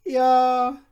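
put-on old tailor. A narrator's reading. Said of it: "erwiderte jener". (alter Schneider, höher und lauter)

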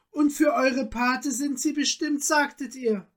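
high, astonished query; taken-aback questioning. A narrator's reading. Countess questions Felix about the godmother's stones, taken aback. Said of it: "fuhr sie betroffen zurück".

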